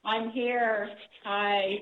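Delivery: echoey speakerphone sound; distant, roomy phone audio; phone held away. Real, not acted. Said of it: "you sound very echoey".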